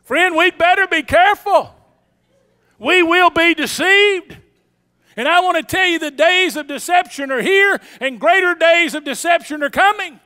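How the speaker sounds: high, loud pulpit warning; full-force preaching. Real, not acted. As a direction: sound the alarm to the congregation.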